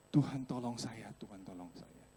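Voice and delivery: quiet re-enacted plea to God; retold prayer. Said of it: "Saya berdoa gitu".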